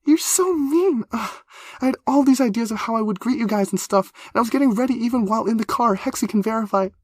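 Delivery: put-on very high, loud pouting girl; high whiny complaint. A narrator's reading. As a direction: whine it out, wounded. Complaining to Whippersnap.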